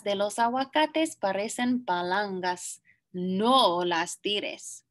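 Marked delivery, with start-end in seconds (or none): none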